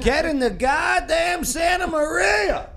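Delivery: put-on high furious shout with Italian accent; Samuel L. Jackson impression. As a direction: bellow it like an angry movie star. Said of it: "could do an Italian accent?".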